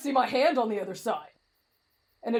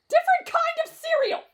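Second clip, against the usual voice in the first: very high frantic yell; exasperated shriek; 14 semitones higher, 3 dB louder than usual. Acted. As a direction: screech the correction. Exasperated correction amid panic.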